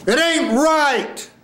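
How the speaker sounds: very high, long-held cry; imitating the student. Shown as a demonstration. Coach imitating the student's blur, high and long-held. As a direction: stretch the line out high, overdone. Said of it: "This is what you did".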